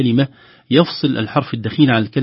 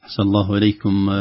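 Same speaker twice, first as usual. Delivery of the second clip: ordinary talk, nothing marked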